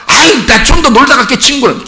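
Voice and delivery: high-pitched teen plea to keep playing; protesting child. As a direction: act out a kid putting off a parent. Acting teen protesting parent's call. (더 놀겠다고 버티는 아이 흉내)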